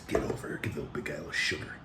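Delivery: soft, fast breathy whisper. Pretend. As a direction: whisper the catchphrase close to the mic. Whispered catchphrase.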